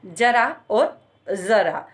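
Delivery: first जरा with j, second जरा with z, slowly; contrast j and z. Shown as a demonstration. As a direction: say जरा twice, j then z, slow. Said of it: "उच्चारण में ध्यान रखिएगा". ("जरा और जरा" धीरे, पहले ज फिर ज़)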